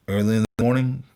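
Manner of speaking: ordinary delivery, nothing marked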